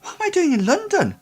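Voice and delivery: put-on very high-pitched, bewildered boy; high voice of a confused lad. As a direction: pitch it right up, lost and nervous. Disoriented boy, bewildered at London.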